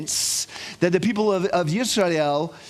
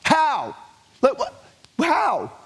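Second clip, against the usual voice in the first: high, drawn-out, baffled how; bewildered how; 7 semitones higher, slower than usual. Shown as a demonstration. Rabbi acting out the meaning of Echa, baffled. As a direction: ask it like you can't believe it. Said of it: "the way it translates is how".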